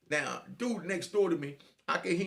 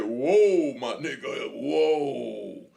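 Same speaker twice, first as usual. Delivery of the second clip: loud, drawn-out whoas; stunned protest; 9 dB louder, much slower than usual. Retold or imitated. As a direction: stretch each whoa to halt him in disbelief. Acts out his stunned reaction.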